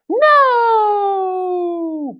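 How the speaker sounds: high, long-held refusal; determined storybook cry; determined Piggy. Acted. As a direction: stretch it out high and firm. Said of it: "Piggy is determined".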